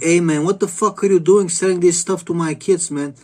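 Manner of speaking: retold angry yell. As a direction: yell at the ketchup boss for selling this to your kids. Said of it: "You're like".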